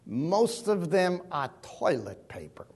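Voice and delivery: slow, low imitation of an Austrian accent; a touch of an old Austrian currency expert's accent. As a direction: put on a light Austrian accent, slowly. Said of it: "in that Austrian accent".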